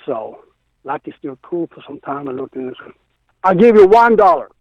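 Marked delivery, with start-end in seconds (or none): 3.4-4.5 high-pitched, loud mock outburst; imitated haggling offer. Acting out friend's absurd haggling offer.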